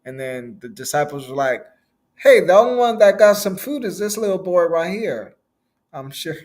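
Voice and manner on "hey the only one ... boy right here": raised, louder disciple's shout-out; calling out. Retold or imitated. Disciples pointing out the little boy to Jesus, calling out. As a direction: call over to get the boss's attention.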